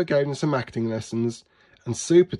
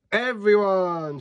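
high, drawn-out call to the crowd; storybook hero hailing a crowd; shouted announcement; 7 semitones higher, slower than usual. A narrator's reading. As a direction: call out slowly to get everyone's attention. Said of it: "shouted Sweet Potato".

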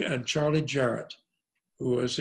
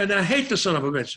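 quick, higher, louder venting; retold indignant outburst; 5 semitones higher, 5 dB louder, faster than usual. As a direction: blurt it out in mock outrage. Retelling his outburst at the producer.

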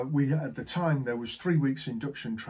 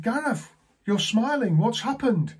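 high, loud, imitated surprise; pleasantly surprised; 8 semitones higher, 5 dB louder than usual. Manager to suddenly smiling agent, pleasantly surprised. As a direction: act out delighted curiosity.

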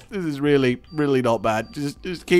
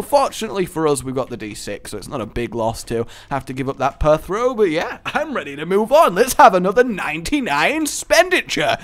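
usual speaking voice

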